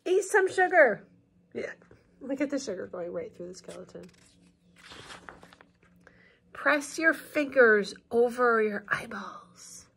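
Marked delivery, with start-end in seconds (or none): 0.1-1.1 put-on slow storybook reading for kids; picture-book narration; read-aloud narration. A narrator's reading. 6.5-9.9 put-on slow storybook reading for kids; picture-book narration; read-aloud narration. A narrator's reading.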